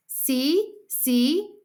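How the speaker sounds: put-on high, loud little-kid voice; excited small child; showing-off toddler. A narrator's reading. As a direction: pipe up eagerly for attention. Toddler shows off on jungle gym.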